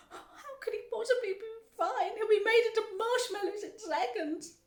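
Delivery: high, loud distressed protest; frantic wailing. A narrator's reading. Mother fearing son becomes marshmallows, frantic wailing. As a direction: wail in panic for your child.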